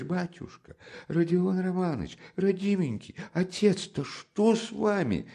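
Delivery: high, fluttering frightened fuss; fussing old investigator. Acted. Porfiry fusses over Raskolnikov in high alarm. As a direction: coddle him in alarm.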